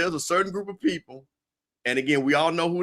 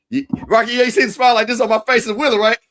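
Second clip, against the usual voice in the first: excited; 10 semitones higher, 9 dB louder than usual. Real, not acted. Excited shout-out on the show.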